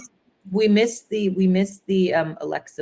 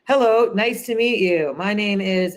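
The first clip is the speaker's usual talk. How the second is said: slower, higher and louder, a stiff forced hello; awkward stiff greeting; 4 semitones higher, 5 dB louder than usual. Retold or imitated. Pitcher greeting investors, stiff and awkward. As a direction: play the nervous pitcher reciting an intro. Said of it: "when they're even awkward themselves".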